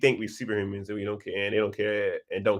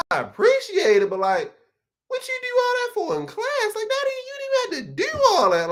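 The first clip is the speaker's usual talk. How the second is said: much higher, squeaky disbelief with a laugh; incredulous. Retold or imitated. Student replying to the professor, high incredulous squeal. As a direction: sound baffled and a little offended. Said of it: "I'm like".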